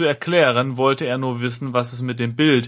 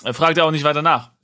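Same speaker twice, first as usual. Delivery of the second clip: usual speaking voice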